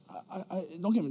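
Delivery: normal talking voice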